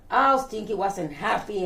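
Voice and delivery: plain reading voice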